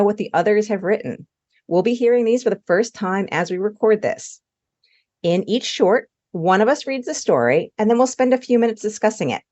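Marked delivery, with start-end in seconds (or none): none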